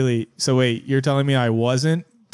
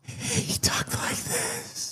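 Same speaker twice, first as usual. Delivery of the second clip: very slow, airy whisper; exaggerated soft-spoken; 9 dB quieter, much slower than usual. Shown as a demonstration. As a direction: whisper a hammed-up soft-spoken teacher, drawn right out. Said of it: "He was softer than that".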